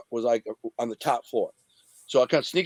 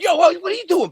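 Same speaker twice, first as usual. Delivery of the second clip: very high, loud challenge; retold alarmed shout; 6 dB louder than usual. Storyteller seeing the man reach under the seat, high alarmed shout. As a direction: call out sharply in alarm, as retold.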